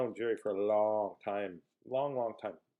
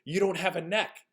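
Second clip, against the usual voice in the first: imitated child, higher and more emphatic; little boy repeating himself; 6 semitones higher than usual. Little boy repeating for the puzzled visitor, emphatic. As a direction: say it up high, making it clear. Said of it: "Pardon me?".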